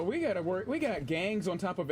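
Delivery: normal talking voice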